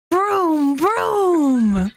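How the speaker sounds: vroom vroom. Pretend. Joke punchline, car noise imitation.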